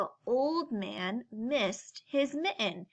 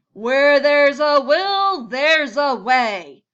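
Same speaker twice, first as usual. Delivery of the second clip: booming, slow, much louder bear; big bear voice; 3 semitones higher, 15 dB louder, slower than usual. Acted. Bear booming his name at the crowd inside. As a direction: roar it out like a huge jolly bear.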